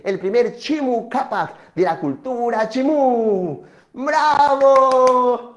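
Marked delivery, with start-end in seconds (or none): 3.9-5.6 loud, drawn-out bravo; rousing cheer. Acted. Storyteller cheers the finished myth, loud and drawn-out. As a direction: stretch it out and project.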